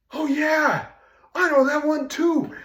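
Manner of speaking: high, loud burst of enthusiasm; excited agreement. Retold or imitated. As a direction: jump in eagerly, high and loud. Excitedly answering the singer's offer.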